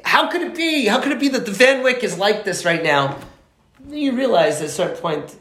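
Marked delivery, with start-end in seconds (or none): 0.0-3.2 mock road rage. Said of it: "You rage".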